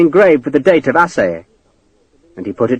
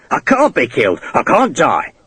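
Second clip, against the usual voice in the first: mildly raised character voice; shouted protest. A narrator's reading. Desperate prisoner insisting to his enemy, restrained shout. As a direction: insist like a desperate man, without full volume. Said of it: "he shouted".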